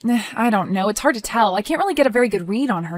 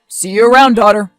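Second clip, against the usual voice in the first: louder mocking farewell; taunting; 8 dB louder than usual. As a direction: sneer the goodbye. Taunting goodbye in quarrel.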